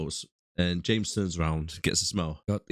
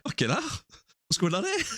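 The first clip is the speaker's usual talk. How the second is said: mock alarm; 11 semitones higher, faster than usual. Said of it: "I'd be like".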